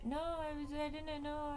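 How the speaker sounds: high, whiny imitation of a kid's excuse; high-voiced kid backpedaling; mock protesting kid.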